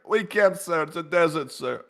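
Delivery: a hint of a glum aide; lightly voiced subordinate refusing.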